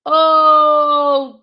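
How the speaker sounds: loud sudden "Oh" of dismay; frustrated yelp. Real, not acted. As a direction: blurt it out, caught out. Answer marked wrong.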